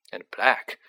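plain reading voice

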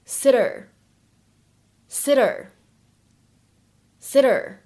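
"sitter" with an American flapped t. Shown as a demonstration. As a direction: tap the tt in "sitter" between t and d. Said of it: "美式发音当中所独有的闪音的处理".

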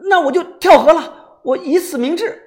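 high, loud, wounded vow; overwrought, indignant ultimatum; melodramatic threat. Acted. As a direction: declare it with hammed-up desperation. Melodramatic threat to drown himself.

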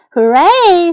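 a cheer of delight, lightly acted; excited cheer. A narrator's reading. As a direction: call out a storybook hooray. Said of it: "奥利弗大喊道".

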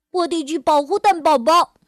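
high and loud resolve; determined. Acted. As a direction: brave little hero vow. Little dinosaur resolves to protect eggs. (坚定地大声下决心)

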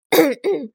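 short, high throat clear; polite ahem. A narrator's reading. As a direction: clear the throat before a speech. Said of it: "清清嗓子".

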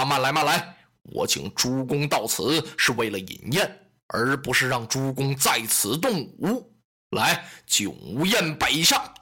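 a lightly voiced commanding warlord, slowing at the end; Lü Bu's voice. Lü Bu calms the feast.

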